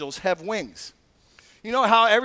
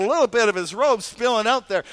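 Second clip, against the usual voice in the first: ordinary talk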